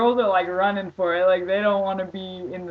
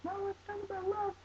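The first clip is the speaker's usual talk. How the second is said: high-pitched quote; 11 dB quieter than usual. Retold or imitated. Quoting himself in a high voice.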